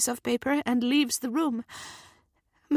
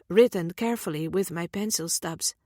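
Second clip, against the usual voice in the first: ordinary delivery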